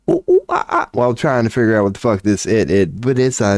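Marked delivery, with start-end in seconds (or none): 0.1-0.9 high, hooting monkey calls; mock ape calls pitched way up; chimp hoots. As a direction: hoot like a chimpanzee figuring things out. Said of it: "chimpanzees going".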